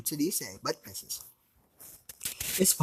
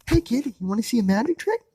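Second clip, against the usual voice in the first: friendly stranger; 10 semitones higher, 5 dB louder, faster than usual. Acted. Issei offers child magic trick.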